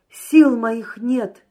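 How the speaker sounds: loud, drawn-out exasperated cry. A narrator's reading. Drunken merchant raging at his friend, crying out. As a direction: cry out slowly, at the end of your strength. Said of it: "кричал он".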